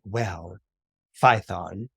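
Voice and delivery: low, hesitant mother; grave parental reveal. Acted. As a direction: lead in gently and low. Mother begins a reluctant revelation.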